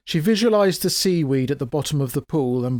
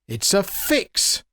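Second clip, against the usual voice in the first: shouting; 6 semitones higher, much slower than usual. A narrator's reading. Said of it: "he shouted".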